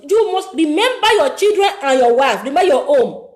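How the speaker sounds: loud, forceful sermon voice; impassioned preaching. Acted. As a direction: preach it hard to the gamblers.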